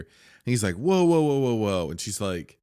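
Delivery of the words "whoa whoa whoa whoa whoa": higher, louder retold string of whoas; mock startled whoa. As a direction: act out halting someone in alarm. Retold man trying to calm her.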